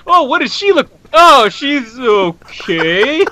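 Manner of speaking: mock-imitating her lukewarm verdict, slow and drawn out; mocking cohost. Teasing cohost about judging the gymnast, mock lukewarm. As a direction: parody her unimpressed tone. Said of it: "As you're about to send your fan message".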